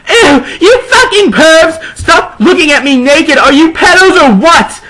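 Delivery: high, loud hammed-up scream; mock outraged yell. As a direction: shriek at the peeping pervs. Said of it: "I screamed".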